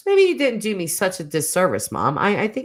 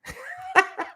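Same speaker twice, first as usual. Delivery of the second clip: high laugh. Real, not acted. Squeaky laugh after joke.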